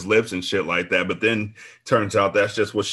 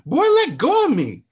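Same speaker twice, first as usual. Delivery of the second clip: shouting; 6 dB louder than usual. A narrator's reading. Woman fighting off the trainer, high shriek. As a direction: shriek it up high, pushing him off. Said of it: "I shouted to the top of my lungs".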